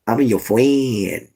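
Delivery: slow, drawn-out mock voice; put-on friend voice. Streamer to chat, playfully doing a friend's slow voice. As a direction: stretch it out slowly in a put-on voice. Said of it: "the reason why I did that voice".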